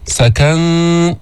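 "سكن" chanted long, its final ن nasal and not heavy; light nasal hiding. Shown as a demonstration. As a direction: hold a light hum on the ن of "سكن". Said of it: "بدون تفخيم الإخفاء".

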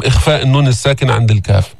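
normal talking voice

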